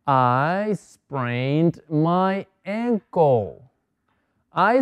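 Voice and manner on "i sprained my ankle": very slow, every word drawn out; slow model sentence. Shown as a demonstration. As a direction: dictate it slowly for the class. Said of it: "Observen que estoy diciendo ankle".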